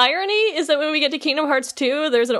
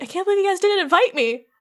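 mock indignant; 8 semitones higher, faster than usual. Acting out the left-out member.

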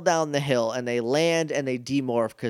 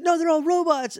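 much higher frantic warning; alarmed Justin. Retold or imitated. Justin telling Alpha about the robots, high and frantic. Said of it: "he's like".

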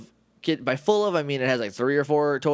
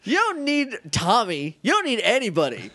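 high-pitched incredulous rant; exasperated scolding; 9 semitones higher than usual. Pretend. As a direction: scold a helpless character in mock exasperation. Scolding the helpless character, exasperated.

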